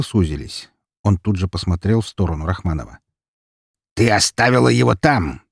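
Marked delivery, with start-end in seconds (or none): 3.9-5.4 raised, cold reproach; pointed, chilly reproach; cold accusation. A narrator's reading. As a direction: accuse coldly. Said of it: "холодно проговорил он".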